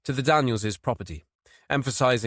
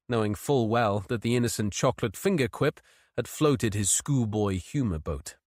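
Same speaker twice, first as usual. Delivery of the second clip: ordinary delivery, nothing marked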